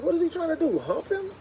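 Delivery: high, incredulous question; high-pitched disbelief; shocked. Retold or imitated. To the neighbor watching the dogs, shocked and high.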